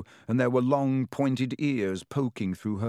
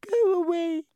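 tiny high-pitched squeak. Acted. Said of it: "Hermione squeaked".

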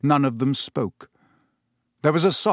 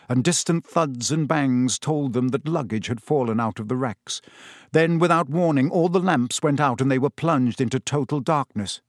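plain reading voice

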